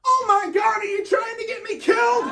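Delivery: frantic caller losing it with 911; panicked outrage. Retold or imitated. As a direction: yell it in disbelief at the operator.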